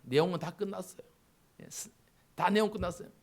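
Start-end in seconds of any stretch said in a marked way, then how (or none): none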